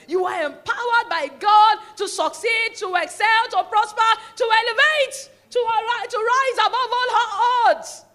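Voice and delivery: loud, high, slow, building preaching shout; preaching at full force. Real, not acted. Rising preaching climax.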